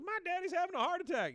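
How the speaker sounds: put-on loud, very high kid's squeal; hammed-up shrieking cry for help; mock scream.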